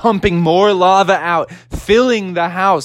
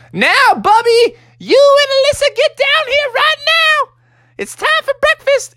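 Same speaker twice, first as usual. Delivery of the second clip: very high falsetto mama voice; bossy Mother Bear; 3 dB louder than usual. Acted. Mother Bear calling children to breakfast, high bossy falsetto. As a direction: order the kids down in shrieky falsetto.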